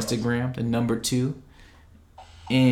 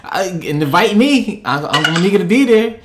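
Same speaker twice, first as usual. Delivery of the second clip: excited; 8 semitones higher, 8 dB louder than usual. Real, not acted. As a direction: hype it up, loud and high. Hyping up guest's opening day.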